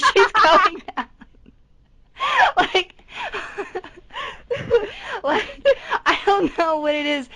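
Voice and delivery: high-pitched helpless laughter; laughing hard. Real, not acted. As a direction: laugh breaking into the words. Laughing at own story.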